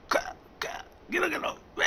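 exaggerated gag noises; imitated retching. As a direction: mimic someone retching at the sink.

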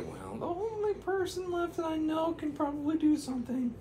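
very high, breathy-free squeaky princess voice; high falsetto character voice, slowing at the end. Acted. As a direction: squeak it up high like a cartoon heroine. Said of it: "she said".

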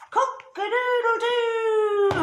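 very high, slow mock crow; robber imitating crow. A narrator's reading. Robber mimics the monster's noise, high crow. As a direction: copy the scary rooster crow. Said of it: "a very strange noise".

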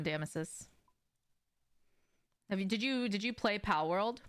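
normal talking voice

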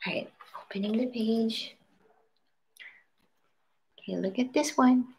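breathy whisper while turning page. Real, not acted. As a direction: hush it, just air. Mother whispers page turn to child.

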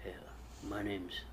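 mocking low, hushed impression; Mad Max. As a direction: imitate his quiet, low hero voice. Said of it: "You're like".

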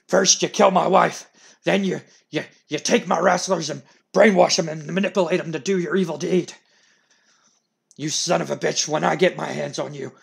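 loud, raging threat; furious Vince. Acted. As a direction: spit out the accusations.

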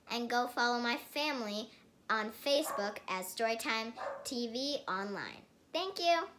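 loud, high promo read; upbeat channel plug. Acted. Kid host plugging her TikTok, loud and eager. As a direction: sell the follows with energy.

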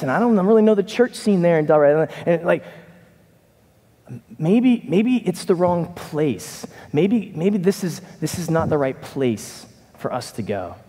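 normal talking voice

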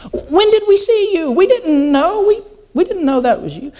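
high-pitched, bewildered protest; baffled sheep. Acted. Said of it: "The, the sheep say".

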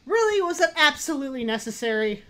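indignant shouting. Acted. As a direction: call out sharply after a fright. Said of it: "sorry for shouting".

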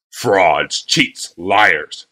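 gruff wagon master barking insults; shouted. A narrator's reading. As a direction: spit out each accusation. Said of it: "shouted Bly".

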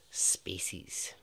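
a teasing whisper; whispered word. Real, not acted. Teasing the episode topic.

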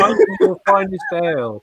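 jokey mock English accent. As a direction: play at being English, accent and all. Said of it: "my best English accent".

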